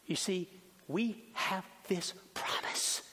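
slow, soft whisper of a promise. Real, not acted. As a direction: breathe the words out gently.

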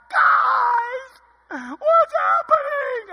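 loud, high wailing cry, slow on the end; hammed-up panicked coach wailing; mock crying coach. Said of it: "Now he's, like, crying".

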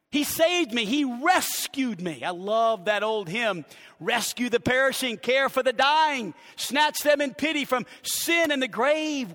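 high, loud, driving sermon delivery; full-force preaching. Real, not acted. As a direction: preach it out to the whole church.

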